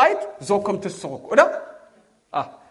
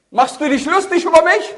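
angry shout; 9 semitones higher, 8 dB louder than usual. Pretend. Young man angry at echo.

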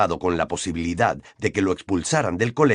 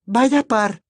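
high, indignant exclamation; furious exclamation; 12 semitones higher, 4 dB louder than usual. A narrator's reading. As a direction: huff it in a raised girl's voice. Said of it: "exclamó Hermione furiosa". (exclamación furiosa de chica, muy aguda)

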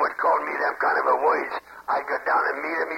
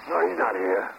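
normal talking voice

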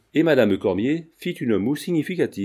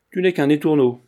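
louder, higher rebuke; stern scolding; 4 semitones higher, 7 dB louder than usual. A narrator's reading. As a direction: scold a cheeky youngster, louder and raised in pitch. Older friend scolds cheeky young man. (réprimande sévère, plus forte)

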